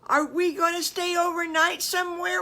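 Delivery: high, loud eager piglet question; eager child asking mommy; eager kid. Acted. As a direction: ask it bouncing with excitement. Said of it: "Wiggly said".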